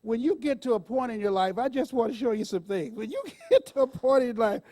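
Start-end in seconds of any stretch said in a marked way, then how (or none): 2.9-4.6 words breaking into laughter, rising high; laughing through it; helpless laughter while telling it. Real, not acted. Overcome with laughter at her pills.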